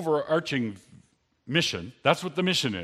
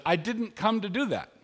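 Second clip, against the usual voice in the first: Jesus, a higher first denial; preacher voicing Jesus, first denial raised; Jesus speaking; 6 semitones higher than usual. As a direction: gently push back as Jesus, stressing do. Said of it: "But Jesus says".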